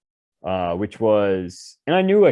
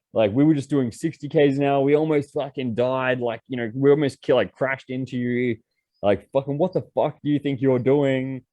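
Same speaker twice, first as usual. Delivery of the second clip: higher, heated retold yelling; yelling tirade; 4 semitones higher than usual. Yelling at the cab driver who nearly caused a crash, heated. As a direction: blast the cab driver for reversing. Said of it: "I was like".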